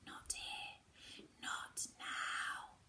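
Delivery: urgent whisper; hushed warning. Acted. Frost man silences the lost girl, whispered. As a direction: breathe it so no one can hear. Finger to her mouth, hushing.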